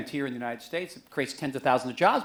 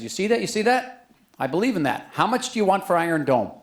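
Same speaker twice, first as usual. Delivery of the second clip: louder, higher Texan congressman, eager; congressman quoted; 4 semitones higher, 7 dB louder than usual. Retold or imitated. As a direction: play the eager congressman pointing at the Bible. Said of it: "the congressman would point to that and say".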